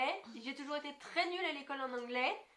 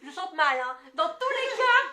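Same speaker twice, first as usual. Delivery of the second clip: laughing; 3 semitones higher, 11 dB louder, slower than usual. Real, not acted. Laughing about her bad singing.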